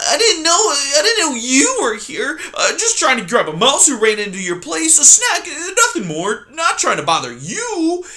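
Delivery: high, loud, sniveling fox. Acted. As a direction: grovel to the porcupine.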